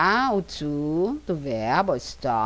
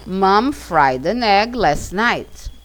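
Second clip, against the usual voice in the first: slow model sentence; 5 dB louder, much slower than usual. Shown as a demonstration. Teacher models example sentence.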